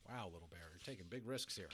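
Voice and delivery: ordinary delivery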